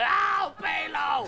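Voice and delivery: slow, high agonized wail; screaming. Retold or imitated. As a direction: scream after him as he slips away, hammed-up. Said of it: "as I'm screaming at that".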